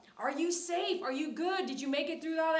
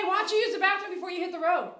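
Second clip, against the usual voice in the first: louder, higher, quicker call across the room; 6 semitones higher, 6 dB louder, faster than usual. Real, not acted. Calling to child off-mic.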